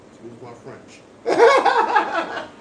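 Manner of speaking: hammed-up laughing "Excuse my French"; laughing apology. Shown as a demonstration. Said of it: "That was said on purpose".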